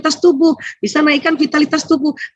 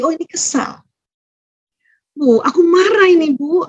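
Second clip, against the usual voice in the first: put-on higher kid voice naming feelings; child telling mother it is angry; 6 semitones higher than usual. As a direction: speak like a small child to mom.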